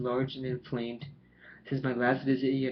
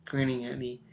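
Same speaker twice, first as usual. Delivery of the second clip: ordinary delivery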